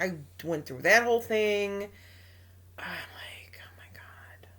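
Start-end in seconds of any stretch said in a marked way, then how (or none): 2.8-4.5 whispered oh my God to herself; hushed, voiceless aside; whispered exasperation. Retold or imitated. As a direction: breathe it out in a near-silent whisper. Recalls own exasperated private reaction.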